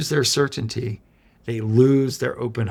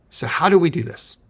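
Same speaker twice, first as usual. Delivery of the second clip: raised, louder rhetorical question; 6 semitones higher, 4 dB louder than usual. Real, not acted. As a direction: lift the question up to pull them in. Rhetorical question to open advice.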